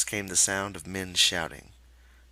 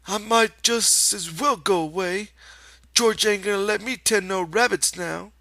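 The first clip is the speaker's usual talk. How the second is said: put-on high childlike sulk; sulky Lenny; 14 semitones higher, 7 dB louder than usual. Lenny sulks to himself, high childlike voice. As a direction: mope like a scolded child.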